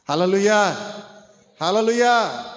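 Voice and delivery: hallelujah called out loud to the room. Real, not acted. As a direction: call it out to gather everyone's attention. Calling the congregation to attention. (గట్టిగా హల్లెలూయా పిలుపు)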